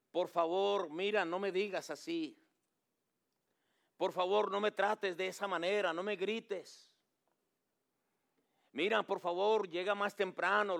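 pleading wife. Retold or imitated. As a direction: beg your husband again and again. Preacher voices pleading tired wife. (esposa cansada suplicando al marido, más aguda)

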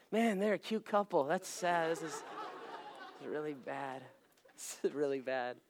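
very soft, low, slow; dejected muttering. Retold or imitated. Husband framing photo of wife and friend, soft dejected mutter. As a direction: say it under your breath, low and defeated.

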